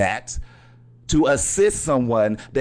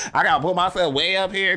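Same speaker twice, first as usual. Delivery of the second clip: higher voice for way up here; 7 semitones higher than usual. Retold or imitated. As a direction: lift the voice up to the top. Climbing back up, voice rising.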